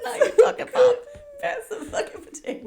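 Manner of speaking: high, loud, laughing through the line; laughing punchline. Real, not acted. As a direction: crack up while repeating the punchline.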